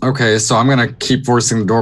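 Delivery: ordinary delivery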